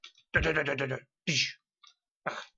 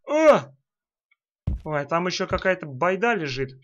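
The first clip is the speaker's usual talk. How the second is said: ordinary delivery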